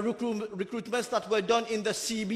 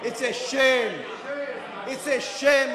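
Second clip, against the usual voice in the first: indignant shout; 6 semitones higher than usual. Real, not acted. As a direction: blast the shame at them. MP denounces government amid heckling.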